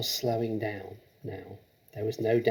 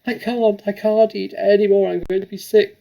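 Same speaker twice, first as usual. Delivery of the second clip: much higher, louder desperate wail; crying out; 10 semitones higher, 11 dB louder than usual. Acted. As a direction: plead that you can't eat more. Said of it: "stop and cry out".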